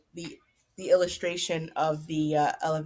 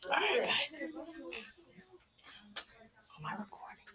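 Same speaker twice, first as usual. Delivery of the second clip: whispered aside, voice unvoiced; 9 semitones higher, 11 dB quieter than usual. Real, not acted. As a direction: ask under your breath so the recording won't hear. Whispered aside while recording.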